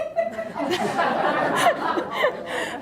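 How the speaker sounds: bursting into a high laugh; high-pitched laugh. Real, not acted. Laughs after the joke.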